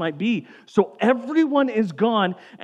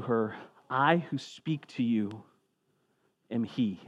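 scripture reading; 7 semitones lower, 7 dB quieter than usual. Acted. Reads scripture passage.

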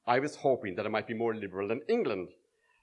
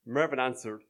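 ordinary delivery

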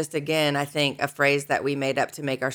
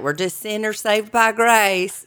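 slow, higher-pitched country drawl; country accent; 6 semitones higher, 4 dB louder, slower than usual. Retold or imitated. Co-host imitating the pastor on stage, country accent. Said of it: "a real country accent".

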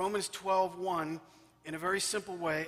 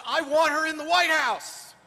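loud, higher, gushing insistence; excited demand; 9 semitones higher, 11 dB louder than usual. Retold or imitated. President phoning back to praise the housekeeper, loud excited demand. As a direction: rave and demand at once.

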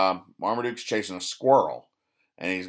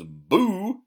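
spooky boo; 10 semitones higher than usual. Acted. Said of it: "pops up from under a rug and goes".

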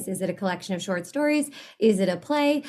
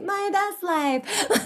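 higher, drawn-out mock bragging; mock showing off; 9 semitones higher, much slower than usual. Guest imagining her social media posts, mock showing off. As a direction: play at flaunting it, slowly. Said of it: "everything around me is so showy offy".

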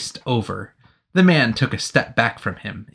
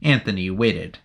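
usual speaking voice

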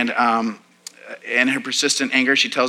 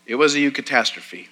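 normal talking voice

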